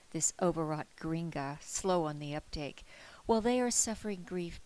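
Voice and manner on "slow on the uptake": ordinary delivery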